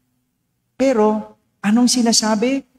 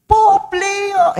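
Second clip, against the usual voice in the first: put-on child shouting; 12 semitones higher than usual. Priest imitating a little boy calling the Pope, high child's shout. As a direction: call out like a small child. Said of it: "At pinasisigaw niya".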